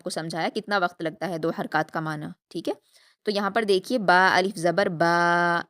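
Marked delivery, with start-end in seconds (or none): 4.0-5.6 با الف زبر با, final با held long; stretched madd. Shown as a demonstration. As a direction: read it slowly as a model. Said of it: "روانگی سے پڑھیں گے". (با کو کھینچ کر)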